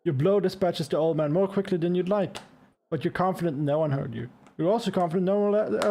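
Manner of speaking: ordinary talk, nothing marked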